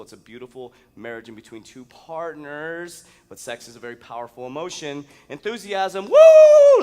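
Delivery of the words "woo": very loud, high-pitched woo; hype-man whoop at full volume; enthusiastic whoop. Acted. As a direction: let out a long piercing cheer. Demonstrates enthusiasm.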